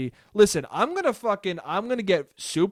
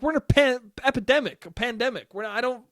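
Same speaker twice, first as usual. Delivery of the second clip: higher, flustered protest; mock exasperation; 7 semitones higher than usual. Son correcting dad about stand-up, flustered exasperation. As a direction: stumble through it in disbelief.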